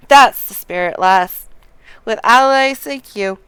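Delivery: loud, rallying officer read; rally a new recruit aboard, loudly; game officer's rousing welcome, projected; hearty Storm officer. Acted. Voices the Storm officer's speech.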